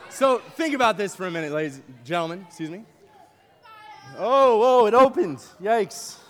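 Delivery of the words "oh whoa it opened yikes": loud, higher burst of alarm; startled surprise. Real, not acted. Speaker startled when the diaper bag opens, loud surprise. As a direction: caught off guard as it opens.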